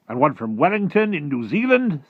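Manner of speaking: loud, high-pitched military report; blustering army chief. Acted. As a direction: carry on the report at full volume. Army chief continues urgent report.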